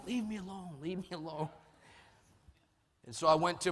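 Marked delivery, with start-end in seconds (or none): 0.1-1.5 higher, strained inner voice; high-pitched mock pleading under the breath; mock inner plea. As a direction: play an exasperated silent protest. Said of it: "inside I'm going".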